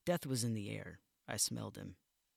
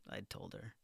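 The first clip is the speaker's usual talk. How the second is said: ordinary delivery, nothing marked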